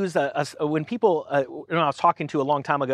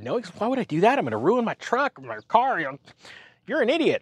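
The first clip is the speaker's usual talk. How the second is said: annoyed guy; 6 semitones higher than usual. Retold or imitated. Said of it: "of course he said".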